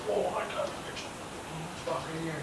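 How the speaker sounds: breathy hunter's whisper so the buck won't hear; hushed whisper. Retold or imitated. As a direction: keep it barely voiced, excited but hushed.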